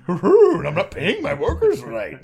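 high, loud cartoon dog voice; laughing through a Scooby impression; Scooby-Doo voice. Mocking rich people as Scooby.

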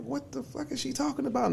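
high, puzzled inner voice; confused. Retold or imitated. Confused at thrown items.

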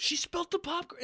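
put-on high-pitched protesting kid; tattling child. Older girl to mother about popcorn, high tattling. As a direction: imitate a girl telling on her little sister.

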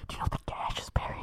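sudden unvoiced whisper; whispering after being told he's too loud. Real, not acted. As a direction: drop to a breathy secret. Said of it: "so loud no one else can be audible".

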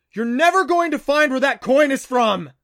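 put-on boy finally exploding at the lecturing adults; bellowing. A narrator's reading. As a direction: let it burst out at full volume. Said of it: "he bellowed".

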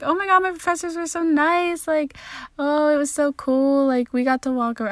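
high, bubbly imitation of gushing friends; mock their over-the-top excitement. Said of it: "everyone else I talked to was like".

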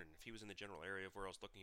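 usual speaking voice

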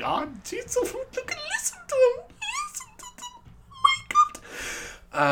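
tiny, very high squeak; thin falsetto gushing; mock overwhelmed. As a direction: squeal softly as if overcome, hammed-up. Acting his reaction to the tribute.

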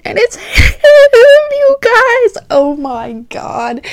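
high squealing cry; squeal, overwhelmed, high and slow; excited squeal. Real, not acted. Reliving seeing him arrive.

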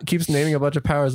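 ordinary talk

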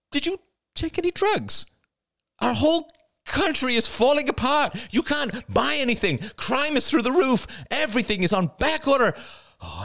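put-on young woman character voice. Crystal's niece pleading with her aunt, character voice. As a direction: give the character her own bright young voice.

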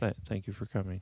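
public radio host. Pretend. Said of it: "I'm trying to sound like I'm on public radio right now".

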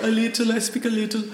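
high, quick mock modesty; French locals' modest English. French locals answering the visitor, playfully imitated modest reply. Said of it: "and they're, they're, but they're like".

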